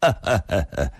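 short, falling mock laugh; acted cold chuckle in separate beats; cold sneering laugh. A narrator's reading. Lü Fengxian stops without turning round, laughing coldly. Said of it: "冷笑地说道".

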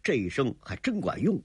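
ordinary delivery

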